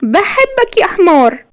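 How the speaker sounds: slow, high-pitched woman's wail; tearful confession. A narrator's reading. Woman calls back the man she loves, tearful. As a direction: draw it out high, as if crying. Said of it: "بتقول بصوت مخنوق من العياط".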